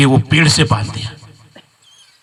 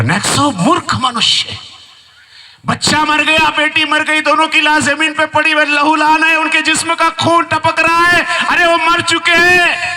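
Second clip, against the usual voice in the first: furious taunt; 10 semitones higher than usual. Acted. Commander taunting the father over the bodies, high and building. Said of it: "सेनापति Nexo को बोला".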